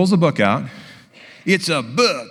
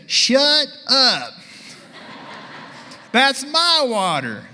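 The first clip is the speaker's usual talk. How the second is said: higher, slow mock retort; feisty patient; 8 semitones higher, much slower than usual. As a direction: snap back at him, playfully. Patient snaps at doctor in story.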